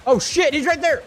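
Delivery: high, loud alarmed cry; startled shout. Real, not acted. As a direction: yell in sudden alarm. Enemy spotted in game.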